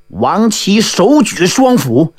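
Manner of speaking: usual speaking voice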